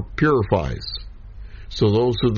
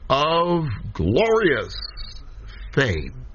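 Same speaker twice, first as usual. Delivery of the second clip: "glorious" stretched long and pitched high; stress on glorious; 4 semitones higher, much slower than usual. Shown as a demonstration. Teacher reads out the emphasized adjective, heavy stress on "glorious". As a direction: draw out "glorious" slowly, higher, then drop on "fame". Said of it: "and is emphasized".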